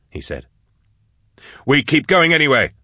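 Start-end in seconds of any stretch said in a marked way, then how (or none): 1.7-2.7 higher, urgent insistence; determined, pushing onward; insistent. A narrator's reading. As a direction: press the point in a raised pitch. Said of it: "insisted Drusha".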